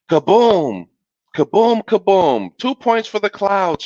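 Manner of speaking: high, booming kaboom cheer; child's shout. A narrator's reading. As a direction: shout it like a boy cheering thunder. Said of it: "shouted Little Bill".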